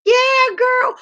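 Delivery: high squeaky kiki giggle; goofy giggle. Retold or imitated. Imitating a friend caught out, goofy high giggle. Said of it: "goofy laugh".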